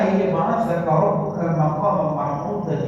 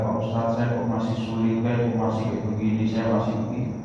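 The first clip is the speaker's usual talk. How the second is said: lower, softer mock whine of a struggling believer; mimicking a complainer; 7 semitones lower, 4 dB quieter than usual. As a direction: play a believer whining to the cleric. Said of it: "Jangan kemudian melihat". (meniru jamaah yang mengeluh dengan suara lebih rendah dan pelan)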